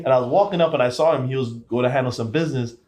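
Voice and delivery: ordinary talk, nothing marked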